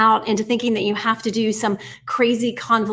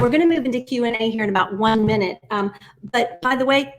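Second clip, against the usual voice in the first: usual speaking voice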